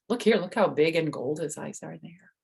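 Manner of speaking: ordinary delivery, nothing marked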